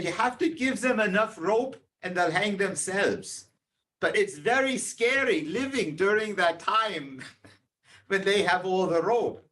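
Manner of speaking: a hint of the wise father passing on a lesson; father's advice. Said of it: "He said".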